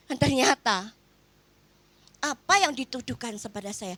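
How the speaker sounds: usual speaking voice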